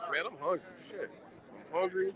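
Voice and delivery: ordinary talk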